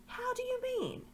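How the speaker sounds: high put-on voice for Drinian; a sailor puzzled by the word; Drinian's voice. Drinian questions Eustace, in a high puzzled voice.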